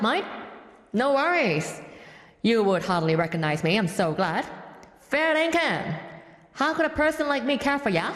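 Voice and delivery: hammed-up Aussie cousin reading lyrics; Australian accent. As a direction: recite it in a broad put-on Australian accent, often lifting the pitch. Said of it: "I guess they are Australians".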